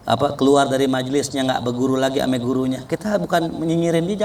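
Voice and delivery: usual speaking voice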